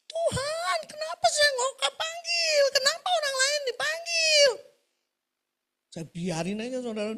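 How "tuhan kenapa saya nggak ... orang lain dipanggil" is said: very high, wailing imitation of an old woman; hammed-up high-pitched tearful plea; crying lament. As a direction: wail to God in mock tears. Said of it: "dia nangis kan".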